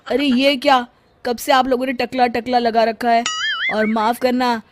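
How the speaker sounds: loud furious yelling, voice climbing high; angry shout. Acted. Father yelling at the insulting guests to leave, furious. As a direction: throw the guests out in a rage. Bala's father angrily throws them out.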